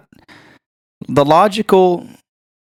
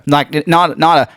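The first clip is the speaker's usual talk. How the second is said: normal talking voice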